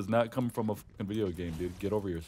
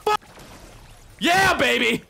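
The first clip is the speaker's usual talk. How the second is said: excited cheer; 10 dB louder, slower than usual. Real, not acted. Celebrating a kill.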